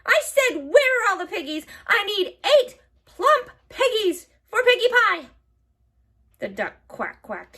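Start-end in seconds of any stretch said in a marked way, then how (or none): 0.0-5.3 high, loud bossy hag; witch shouting. A narrator's reading. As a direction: yell orders at the duck. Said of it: "Gritch shouted to a duck".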